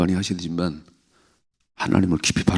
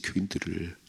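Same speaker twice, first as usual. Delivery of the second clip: 교인들을 repeated much softer, falling; quiet echo; 12 dB quieter than usual. Real, not acted. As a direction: drop to an aside for 교인들을.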